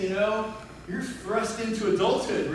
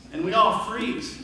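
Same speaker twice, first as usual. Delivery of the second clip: usual speaking voice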